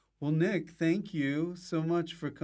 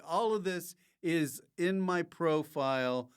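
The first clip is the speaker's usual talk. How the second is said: normal talking voice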